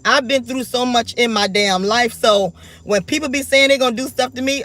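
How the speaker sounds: usual speaking voice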